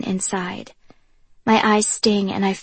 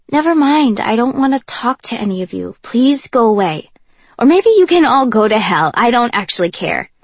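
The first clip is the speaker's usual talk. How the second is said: louder, rising to a furious shout at hell; angry outburst; 3 semitones higher, 7 dB louder than usual. Acted. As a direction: snap them away, building to full anger. Heated quarrel with Kenji.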